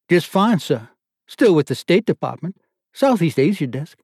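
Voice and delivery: put-on lieutenant answers the board in Australian accent. A narrator's reading. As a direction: answer crisply with Australian vowels. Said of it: "Jiff's accent had a startling effect".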